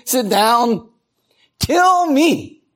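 a sulking prophet who wants to die; whiny mock despair. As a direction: whine it like a sulking child. Jonah whining to die.

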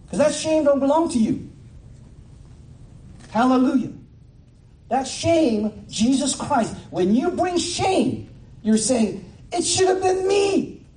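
high, loud climactic preaching; preaching at full force. Acted. Pastor to his congregation, in a loud, high preaching climax. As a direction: lift the congregation, calling out.

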